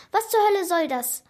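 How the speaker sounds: exasperated shout over to a friend; calling over. A narrator's reading. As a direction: call across the room in disbelief. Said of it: "rief ich rüber".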